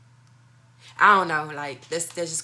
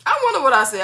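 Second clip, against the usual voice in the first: excited; 7 semitones higher, 8 dB louder, faster than usual. Real, not acted. Bursts out remembering.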